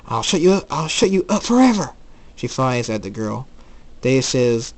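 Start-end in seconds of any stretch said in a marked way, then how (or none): 0.1-1.9 rising, louder, high-pitched threat; angry threat. Acted. Ember Moon flying at the girl, threatening loudly. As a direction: build the threat into a shout.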